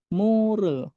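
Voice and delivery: "Mure" in two syllables with an r between; middle R demo. Shown as a demonstration.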